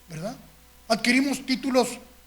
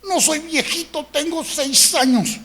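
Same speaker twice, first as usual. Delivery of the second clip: put-on higher, slower kid protesting; lightly voiced indignant little boy; child's voice; 5 semitones higher than usual. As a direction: play the six-year-old insisting on his age. Child answers in retold story.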